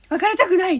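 cling on with a hammed-up plea; clingy refusal. Acting out the type who won't accept a breakup, clingy. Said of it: "ってこうしがみついてくるタイプと".

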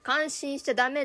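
ordinary talk, nothing marked

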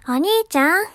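high, loud anime little-sister voice; little-sister character. Pretend. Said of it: "こういう声高い". (声高めの妹系キャラ)

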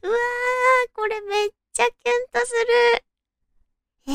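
very high, fast swooning squeal. Real, not acted. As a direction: gush over the sweet moment.

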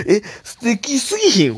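high, drawn-out, loud disbelief; excited gushing. Real, not acted. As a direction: marvel at how lovely it is. Gushing over the listener story. (感激して高い声)

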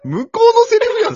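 high, loud laughing retort; real laughing outburst; laughing objection. Real, not acted. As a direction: crack up while protesting.

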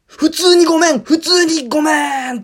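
high, loud shout, slowing; shouted apology. Retold or imitated. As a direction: yell the sorry with all your might. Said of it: "すごい大きい声を出してて。". (必死に叫んで謝る)

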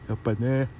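ordinary talk

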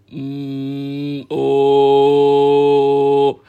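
lowest note she can reach, slow; extremely deep bass voice. Shown as a demonstration. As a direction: drop to your lowest possible hum. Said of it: "これが一番低い。". (出せる一番低い声)

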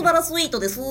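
imitating impression. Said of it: "みたいな".

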